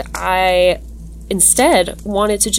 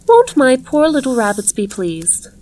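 higher, lilting character voice; delighted hostess; 5 semitones higher than usual. A narrator's reading. Strange woman speaking of her rabbits to a visitor, delighted. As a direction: start high and coo over your pets.

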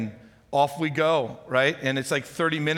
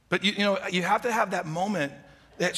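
ordinary talk, nothing marked

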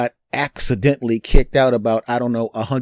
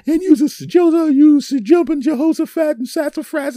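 high, loud hammed-up yelling; mock furious girlfriend; 7 dB louder than usual. Girlfriend storming in, hammed-up shrill yelling. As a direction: scold him in a shrill mock rage. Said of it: "she used lots of curse words".

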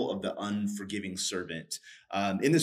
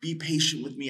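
pleading; 6 semitones higher than usual. Retold or imitated. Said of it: "He says".

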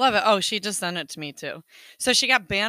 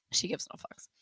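whisper; 6 dB quieter than usual. Real, not acted. Aside kept from mic.

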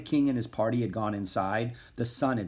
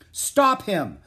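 high, loud, urgent command; shouted order; 10 semitones higher, 7 dB louder than usual. A narrator's reading. King ordering his bodyguards, urgent shout. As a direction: call out sharply like a king giving orders. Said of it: "the king ordered his bodyguards".

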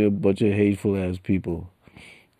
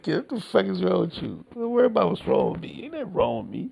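high mock voice of scolding listeners; mocking the critics; 11 semitones higher, faster than usual. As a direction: play the naysayers, pitched way up. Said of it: "Y'all be like".

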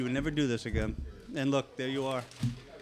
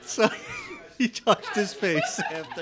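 slow, laughing through the words; giggling it out; slow words cracking with laughter; 11 semitones higher, 4 dB louder, slower than usual. Real, not acted. Laughing at burning chip.

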